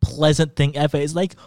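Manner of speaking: normal talking voice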